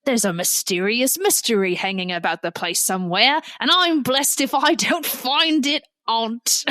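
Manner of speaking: hammy, badly faked British accent; horrible British accent. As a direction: play the indignant servant in a terrible British accent. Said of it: "forgive me for this horrible accent".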